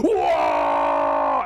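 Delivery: deep guttural orc roar, shouted; orc war cry. Acted. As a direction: bellow it like a charging orc. Orc war cry during charge.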